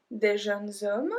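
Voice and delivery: "des jeunes hommes" slowly, z linking jeunes to hommes; liaison. Shown as a demonstration. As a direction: run "jeunes hommes" together as jeune-zom.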